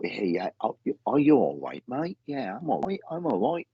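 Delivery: imitated Cockney greeting with swooping pitch; Cockney accent. Medium showing the spirit man's accent, mock Cockney. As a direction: put on a stock Cockney matey voice. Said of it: "he's like Cockney".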